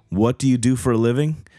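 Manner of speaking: ordinary talk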